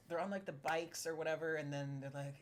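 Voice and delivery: normal talking voice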